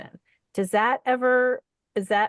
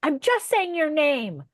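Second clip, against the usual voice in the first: imitated scolding mother; 7 semitones higher than usual. Imitating mother warning the eavesdropping kid, high exasperated scolding. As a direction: mimic an exasperated mother calling out. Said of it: "my mother used to go".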